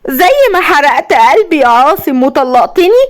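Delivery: high, deranged vengeful threat; unhinged villainess, pitch climbing with spite; gloating. A narrator's reading. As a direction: build from gloating to a crazed vow. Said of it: "وابتسمت بشماتة وقالت".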